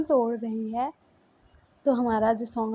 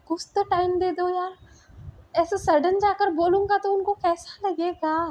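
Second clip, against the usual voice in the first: higher, anxious begging; pleading for time; 6 semitones higher than usual. Acted. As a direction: plead with her for more time. (ऊँची आवाज़ में घबराकर विनती)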